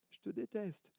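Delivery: high, soft faraway repeat; the yell coming back as an echo; faint distant echo. Acted. Echo answers the girl.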